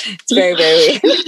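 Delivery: laughing. Real, not acted. Laughing at work slang story.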